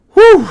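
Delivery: high loud whew of relief; sudden loud high relieved whew. Real, not acted. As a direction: blow out a big whew. Exhale after reading supers.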